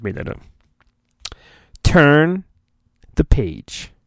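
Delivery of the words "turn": usual speaking voice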